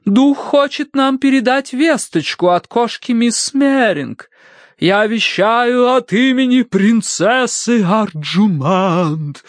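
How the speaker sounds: high, slow spirit wail; inhuman medium's howl. A narrator's reading. Madame channels Princess Arjumand, slow high howling. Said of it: "хрипло провыла она нечеловеческим голосом".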